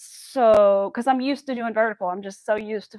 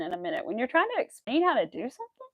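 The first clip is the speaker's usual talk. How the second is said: ordinary talk, nothing marked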